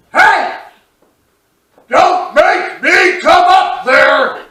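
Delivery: high, slow shout up the stairs; dad's yell. Retold or imitated. Dad yelling up at his sons from downstairs, slow and loud. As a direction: bellow a warning from the chair. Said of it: "and he yells".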